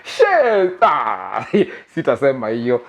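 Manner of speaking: ordinary delivery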